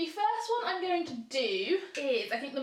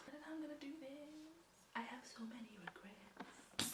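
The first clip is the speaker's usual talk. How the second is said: whispered; 18 dB quieter than usual. Real, not acted. Dreading face mask.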